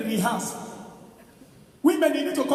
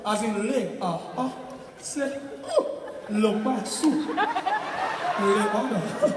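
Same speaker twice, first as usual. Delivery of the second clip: mock tongues; 3 semitones lower, 4 dB quieter, much slower than usual. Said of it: "some girls go say".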